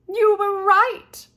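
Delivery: a chirpy Missus Peacock sing-song farewell; high fluttery lady. A narrator's reading. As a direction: trill it like a fussy, delighted lady.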